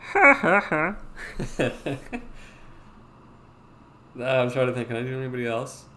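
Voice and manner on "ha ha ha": Cleveland. Acted. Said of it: "I can do a Cleveland".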